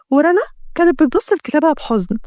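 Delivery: ordinary delivery, nothing marked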